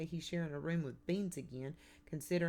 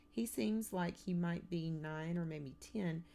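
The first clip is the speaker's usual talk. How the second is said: ordinary delivery, nothing marked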